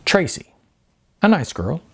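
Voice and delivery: ordinary delivery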